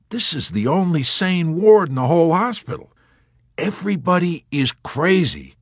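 high, loud insistence; emphatic rant. Acted. Yossarian ranting about the wards.

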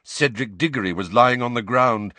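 ordinary delivery

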